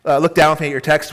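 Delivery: ordinary delivery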